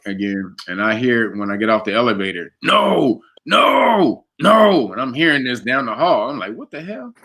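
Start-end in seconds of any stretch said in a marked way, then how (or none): 2.6-4.9 high, drawn-out mock scream; retold screaming. Roommate heard screaming down the hall, imitated scream. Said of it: "He's screaming". 6.5-7.1 hushed, higher-pitched retold bafflement; baffled aside. Man hearing screams down the hall, quietly baffled.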